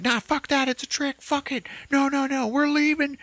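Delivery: high mocking voice, brushing it off; mock Lane refusing. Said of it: "Lane's like".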